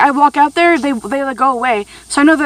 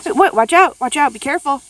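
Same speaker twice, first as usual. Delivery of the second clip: ordinary delivery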